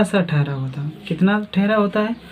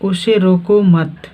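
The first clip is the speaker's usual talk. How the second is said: "रोको मत" joined slowly, stopping after मत; pause after मत; 4 dB louder, slower than usual. Shown as a demonstration. Teacher showing the other comma position, pause after मत. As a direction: run रोको straight into मत, then halt. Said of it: "यहाँ क्या है".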